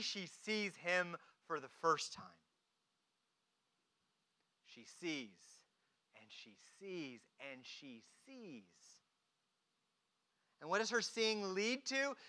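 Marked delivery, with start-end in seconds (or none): 4.6-8.6 very soft, low, fading refrain; hushed awe. Real, not acted. Preacher dwells on Mary finally seeing, hushed. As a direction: let each repeat sink quieter and lower.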